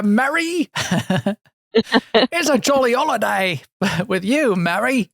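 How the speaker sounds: put-on a terrible movie Cockney accent, sung out high.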